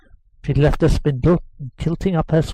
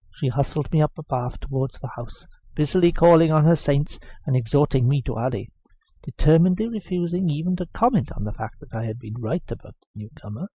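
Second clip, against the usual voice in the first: ordinary delivery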